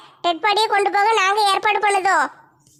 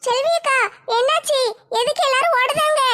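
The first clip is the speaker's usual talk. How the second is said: put-on higher, eager young woman asking; younger woman's voice; 4 semitones higher than usual. A young woman asks Selvi akka why everyone is running, pitched higher. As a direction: ask it pitched up like a curious girl.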